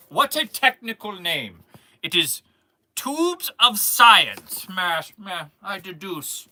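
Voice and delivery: hammed-up British accent, words drawn out; mock British, slow and showy. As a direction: play a grand British scientist. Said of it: "I'll, I'll do a British accent".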